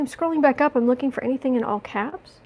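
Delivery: ordinary talk, nothing marked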